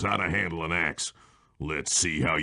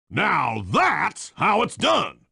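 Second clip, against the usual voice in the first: ordinary talk, nothing marked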